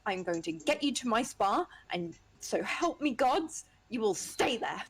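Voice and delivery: slow, stern mother; stern mom. Acted. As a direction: lay down the law like mom. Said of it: "Initiate mom mode".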